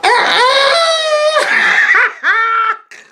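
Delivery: long, loud high squeal breaking into laughter; excited squeal. Retold or imitated. Girl overjoyed watching the couple, squealing. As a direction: squeal with delight at full pitch. Retold delighted reaction to the couple.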